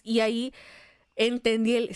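normal talking voice

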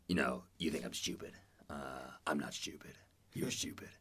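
low, quiet menacing impression; Will Arnett. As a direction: drop your voice, slow mock threat. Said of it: "this guy as Will Arnett".

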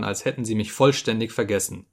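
ordinary delivery, nothing marked

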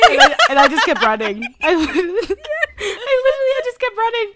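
laughing hard. Real, not acted. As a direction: crack up while telling it. Laughing while retelling her flight.

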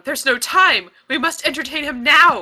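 loud, urgent, pleading cry; desperate shout. Acted. Galena pleads with the clerk, loud and urgent. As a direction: slam the desk and shout it. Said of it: "slam her fists ... and go".

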